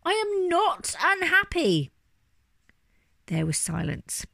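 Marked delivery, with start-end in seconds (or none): 0.1-1.9 high, slow, emphatic outburst; exasperated shouting. A narrator's reading. Bear never allowed to finish his sentence, exasperated shouting. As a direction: cry out each word slowly and high. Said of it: "he shouted".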